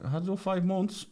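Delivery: high put-on voice of a drunk traveller; traveller's voice. Friend retelling a traveller's jail story, high mock voice. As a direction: pitch the traveller way up and answer bluntly. Voicing traveller answering questions.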